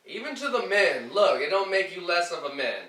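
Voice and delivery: loud, insistent preaching to the men; emphatic. Real, not acted. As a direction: drive the point home hard. Urging men to cry freely.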